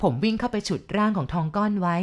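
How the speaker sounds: ordinary delivery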